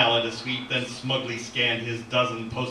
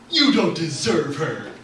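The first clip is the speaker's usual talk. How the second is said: loud, slow, higher-pitched protest; indignant outburst; 4 semitones higher, 6 dB louder than usual. Acted. Jack sneering at the rival statue, indignant. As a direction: throw it as an accusation.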